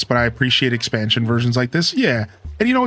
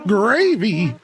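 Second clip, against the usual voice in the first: put-on high, drawn-out "Gravy" in an old-timer voice; old tortoise character; 8 semitones higher than usual. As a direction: play a questioning old tortoise. Tortimer's voice, then repeated plainly.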